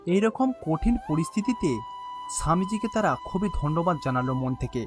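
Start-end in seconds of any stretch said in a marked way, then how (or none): none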